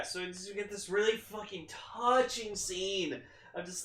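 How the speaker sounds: ordinary delivery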